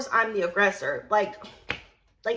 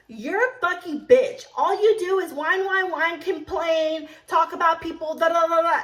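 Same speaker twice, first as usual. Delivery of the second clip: loud, higher-pitched tirade; acted angry rant; 5 semitones higher, 4 dB louder than usual. Server re-enacts a coworker insulting her, angrily. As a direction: rattle off the insults, raised and heated.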